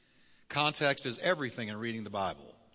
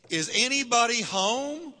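much higher and louder, slow and incredulous; exasperated Jesus; 9 semitones higher, 4 dB louder, slower than usual. Retold or imitated. Jesus mocking the disciples' dullness, high and incredulous. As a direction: knock on their heads with the question. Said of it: "he's saying".

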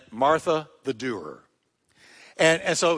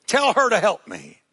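angry Martha; 7 semitones higher, 4 dB louder than usual. Acted. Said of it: "she is mad".

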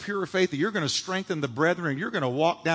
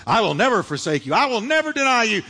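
rising, louder vow on NEVER; Peter's boast; 5 semitones higher, 4 dB louder, faster than usual. Retold or imitated. Preacher voicing Peter's loud boast. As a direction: swear it loud and proud.

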